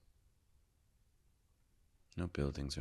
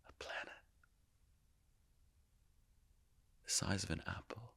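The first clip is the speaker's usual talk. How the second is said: soft, raised pitch, spaced-out phrases; hushed storytelling; 5 semitones higher, 7 dB quieter than usual. Acted. Revealing to listeners the tiny planet in front of them, hushed. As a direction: hush it in wonder, pausing long between images.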